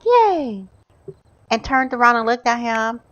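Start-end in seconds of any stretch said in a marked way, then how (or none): 0.0-0.7 high-pitched mimicked cheer; happy cheer. Gamer mimics wife clapping for Jimmy, happy cheer. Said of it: "she was all happy to see him".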